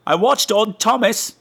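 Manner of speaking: high, slow airy tone; mock listener message. Host mocking a listener's lazy message, high and slow. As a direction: parody a lazy report of homework. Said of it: "He just said".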